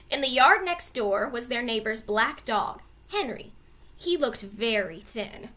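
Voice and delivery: plain reading voice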